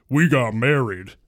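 loud, drawn-out proud announcement; big, hearty loud proclamation; booming. Acted. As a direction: boom the news out slowly. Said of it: "boomed Big Joe".

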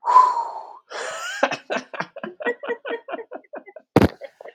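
long high-pitched laugh. Real, not acted. Laughs after teacher's reply.

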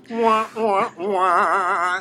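high, loud mock wailing; hammed-up baby wah wah; fake crying. Mocking sisters' misfortune.

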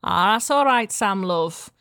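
a light Scouse market-stall mum; lightly voiced Liverpool accent, friendly. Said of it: "while I'm doing a Liverpool accent".

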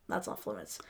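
quiet, quoted ruling; hushed aside. Retold or imitated. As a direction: mutter the rule under your breath. Quoting Monk's house rules.

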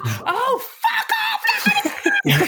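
very high-pitched mock shriek; imitated screaming. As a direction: screech it in an exaggerated imitation of a furious housemate. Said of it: "more along the lines of".

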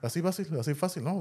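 delighted realization; excited. Real, not acted.

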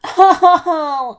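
high, loud moan of "oh"; yelp-moan from a scald; pained moan. A narrator's reading. Wolf burning his finger in tea, moaning. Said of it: "I moaned".

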